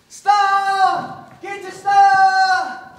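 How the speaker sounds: loud drawn-out vendor call; newsboy street cry. Acted. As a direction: hawk the paper proudly. Newsboy crying his papers.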